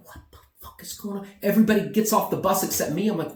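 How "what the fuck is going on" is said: hushed, whispered disbelief; bewildered whisper. Retold or imitated. Traveler on the bus as everybody screams, bewildered whisper. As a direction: whisper it quickly under your breath. His reaction as passengers start screaming.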